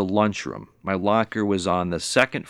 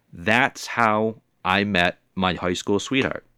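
ordinary talk

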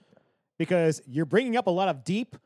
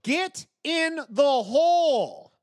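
high, drawn-out mock yell; yelling at the screen; 8 semitones higher, 3 dB louder, much slower than usual. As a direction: stretch each word in exasperated mock yelling. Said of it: "I literally yelled at the screen".

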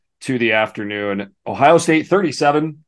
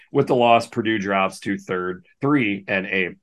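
ordinary talk, nothing marked

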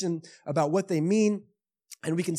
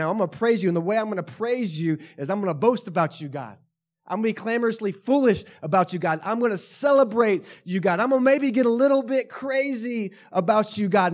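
louder and higher with each line, building to a near shout; building excited praise; 5 semitones higher, 5 dB louder than usual. Acted. Psalmist voiced getting louder, boasting about God. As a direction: voice the worshipper getting carried away.

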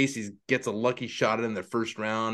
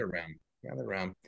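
soft low echo; quiet; 6 semitones lower, 11 dB quieter, faster than usual. Acted. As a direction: murmur it back softly.

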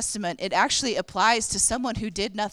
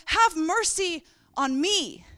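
pleading cry; 8 semitones higher, 4 dB louder, slower than usual. Retold or imitated. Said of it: "And he goes".

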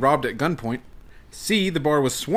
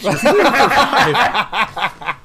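laughing; 8 semitones higher, 7 dB louder, much slower than usual. Real, not acted. Laughing while announcing the score.

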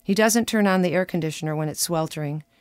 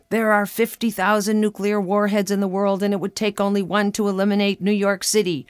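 imitating an insistent, louder lecturing husband; husband's lecture; 3 semitones higher, 4 dB louder than usual. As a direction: reel it off like a practised dinner-party lecture.

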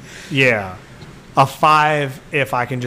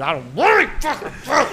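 sulky fan whine; 9 semitones higher than usual. Retold or imitated. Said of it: "repeats the fan's outburst".

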